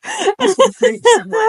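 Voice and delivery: high, squeaky words through real laughter; helpless laughing. Real, not acted. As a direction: crack up while saying it. Retelling anger while cracking up.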